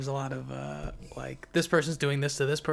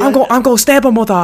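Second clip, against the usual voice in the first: much higher, much louder mock Chinese accent, breaking into laughter; hammed-up fake Chinese accent while laughing; Chinese accent attempt; 11 semitones higher, 19 dB louder than usual. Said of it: "adding a Chinese accent".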